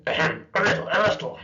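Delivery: put-on wolf faking an old woman with a cold, hamming up a cough; fake sickly granny voice. A narrator's reading. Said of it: "pretending to cough".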